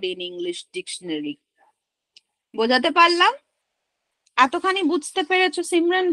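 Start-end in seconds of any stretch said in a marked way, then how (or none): none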